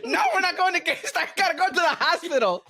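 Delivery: high, loud, laughing; exasperated mom. Retold or imitated. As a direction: scold through a laugh. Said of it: "And she goes".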